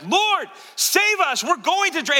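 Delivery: high-pitched, drawn-out cry for help; desperate shout. Acted. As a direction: scream to wake someone in a storm. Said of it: "It wasn't like".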